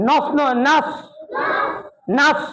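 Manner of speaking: নাস with a full, heavy open vowel, not নস. Shown as a demonstration. Teacher drilling a class on the heavy নাস. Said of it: "মোটা হবে নাস".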